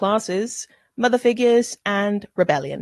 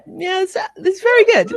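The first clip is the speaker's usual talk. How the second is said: much higher, louder goofy praise voice; silly high voice; 12 semitones higher, 7 dB louder than usual. Pretend. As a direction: squeak it out like a cartoon fan. Jokey put-on voice praising costume.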